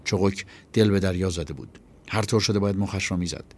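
ordinary delivery

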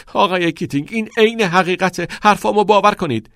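high, loud, urgent plea; pleading pupil. A narrator's reading. Todd insists to Keating it is true, urgent pleading.

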